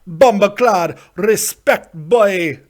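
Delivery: big playful Jamaican patois holler; mock Jamaican accent. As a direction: belt it out in a put-on Jamaican accent. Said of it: "It's not Jamaican, but I decided to go there anyway".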